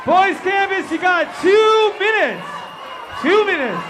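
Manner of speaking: loud, high MC shout to the crowd; rally the whole campus, big and high; crowd hype shout. Real, not acted. MC calling out to the campers, loud and high. MC hyping crowd at camp concert.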